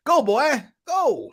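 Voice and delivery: high-pitched mimicked urging; mocking impression. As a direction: mimic him egging fighters on. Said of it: "That's how he sounds to me".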